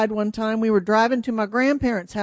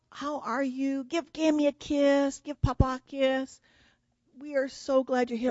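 higher-pitched, gushing welcome; doting grandparents; 5 semitones higher than usual. Retold or imitated. Gammy and Papa welcoming granddaughters at the door, high and doting. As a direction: imitate fussing grandparents greeting the children.

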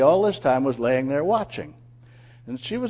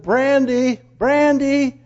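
high, loud call for the dog; 11 semitones higher, 10 dB louder, much slower than usual. Acted. Owner calls his runaway dog, loudly calling out. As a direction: call into the distance, drawn out. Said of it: "I started calling her".

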